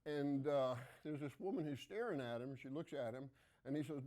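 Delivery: ordinary talk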